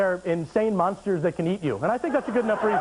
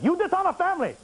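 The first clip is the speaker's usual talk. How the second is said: mock Chinese husband; 9 semitones higher than usual. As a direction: pitch it up in a fake accent, scolding. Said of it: "the husband turns to the wife and says".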